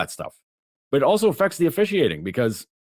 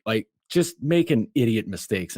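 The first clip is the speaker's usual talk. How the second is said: ordinary talk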